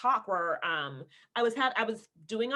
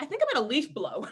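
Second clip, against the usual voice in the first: higher, quick laughing neighbor imitation; mock oblivious neighbor; 6 semitones higher, faster than usual. Neighbor piping up as taping starts, mock-casual. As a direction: mimic the neighbor through a laugh. Mimicking an ill-timed neighbor.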